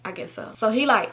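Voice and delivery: ordinary talk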